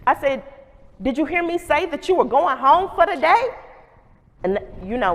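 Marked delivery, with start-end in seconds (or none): 1.0-3.4 higher, pointed scolding with a neck roll; sassy scolding. Retold or imitated. Principal telling Jonathan he's going home, higher and pointed. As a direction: press him with every word.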